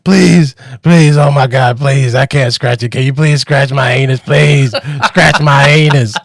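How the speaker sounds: loud, hammed-up desperate begging; begging old lady. Old lady begging a reluctant helper, hammed-up desperation. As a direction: cry out pleas as a mock-frantic old lady. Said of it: "She's like".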